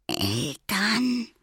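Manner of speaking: small, high, timid question; fearful whisper. A narrator's reading. Little boy hearing of parents, fearful hushed question. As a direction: ask it timidly, pitched right up. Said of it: "flüsterte der Kleine ängstlich".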